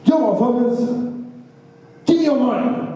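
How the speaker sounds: hammed-up hold-up line; robber voice. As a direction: growl it like a street robber. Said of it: "he's like".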